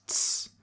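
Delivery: short hissing ts, tongue tapping the teeth; ts sound. Shown as a demonstration. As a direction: clip out a crisp ts. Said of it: "the sound also exists".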